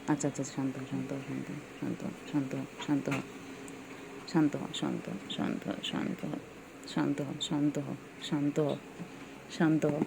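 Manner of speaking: low, soft calming murmur; soothing. Real, not acted. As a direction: settle the angry bird gently. Soothing an angry pet bird.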